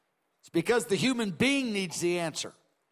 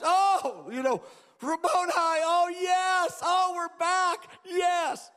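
overjoyed shout; 9 semitones higher, slower than usual. Acted. Mary recognizing her risen teacher, high joyful shouts. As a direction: burst out in joy at the reunion.

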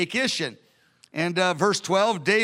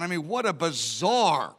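ordinary talk, nothing marked

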